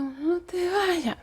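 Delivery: very soft, very slow, strained plea; faint plea. Retold or imitated. Paralysed woman begging her husband to stay, very faint and slow. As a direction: barely push the words out. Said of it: "que él apenas podía escuchar".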